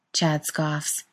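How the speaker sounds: ordinary delivery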